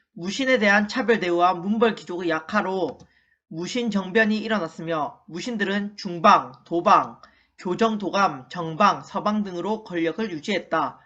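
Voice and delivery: low, even-pitched textbook reading; read-aloud recitation. Shown as a demonstration. Teacher reads the lesson summary for the class to repeat, low measured recitation.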